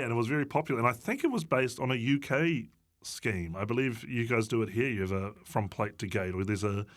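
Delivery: ordinary delivery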